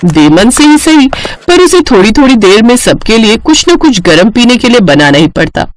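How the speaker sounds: ordinary delivery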